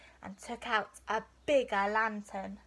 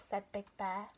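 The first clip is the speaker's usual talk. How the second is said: ordinary delivery, nothing marked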